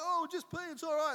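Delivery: high, loud breezy excuse; casual excuse. Retold or imitated. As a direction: shrug it off.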